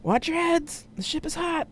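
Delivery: high-pitched yell across a distance; yelled warning. A narrator's reading. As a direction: shout a warning over noise. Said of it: "John yelled".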